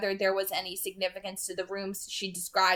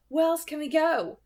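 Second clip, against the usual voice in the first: lightly raised pitch for Alice; a worried young woman's voice, higher; female character voice; 9 semitones higher, 5 dB louder than usual. A narrator's reading. Alice asking Edward where to go, higher female voice.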